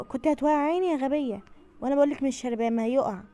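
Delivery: loud furious yelling at her daughter; heated shouting match; angry shout. Acted. Quarrel over the drink.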